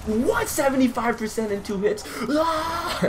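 high disbelieving cry with a laugh; hammed-up shock at the damage; mock outrage. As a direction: fake indignant yelp. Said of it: "Just joking".

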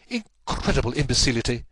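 exasperated exclamation with acted outrage; indignant cry. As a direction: cry out at their stupidity. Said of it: "he cried".

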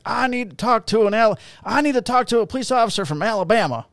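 put-on high, loud drunken demand; drunk hillbilly. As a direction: drunk Alabama guy demanding a hometown officer, high and loud. Drunk hillbilly demanding Alabama cop.

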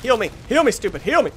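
loud, high repeated call; urgent demand. Real, not acted. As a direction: call out impatiently to a teammate. Demands healing from teammate.

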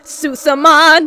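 loud, slow announcer-style call; title shout. Pretend. Punning on Pokemon, loud slow title call. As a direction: boom it like a show title.

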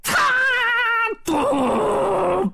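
crack, then long high boom; imitated crash. A narrator's reading. Weapons hit the staff, imitated crack and boom. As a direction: voice the impact and let it ring.

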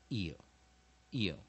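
"eel" slow, three separate falling takes; weak IL syllable. Shown as a demonstration. Teacher modelling the first syllable, "eel" slowly and separately. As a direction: stretch each "eel" with a gap between. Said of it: "所以就念".

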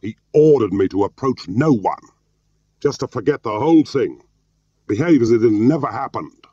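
high, loud, angry outburst; shouting. A narrator's reading. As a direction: shout it as a bitter protest. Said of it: "Jim was shouting at this point".

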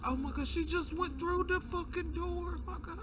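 high frightened cry; panicked. Real, not acted. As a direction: shriek it in terror. Panicked reaction to monster.

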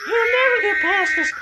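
rallying cry. Real, not acted. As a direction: fire up the team. Rallying team, strained.

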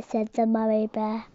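usual speaking voice

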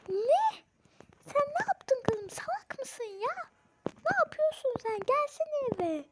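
high, sharp scolding of a daughter; angry mother. Acted. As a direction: snap at her in disbelief.

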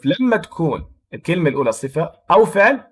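ordinary talk

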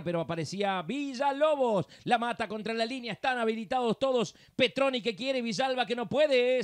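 live play-by-play. Real, not acted. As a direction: call each move as it happens, stretching some names.

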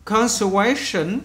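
"Conservation" said slowly, each syllable clear; slow pronunciation. Shown as a demonstration. Teacher modelling a long word, slow careful demo. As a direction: draw out "Conservation". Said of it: "bạn nghe kỹ này".

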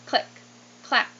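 usual speaking voice

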